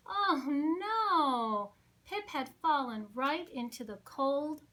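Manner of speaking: usual speaking voice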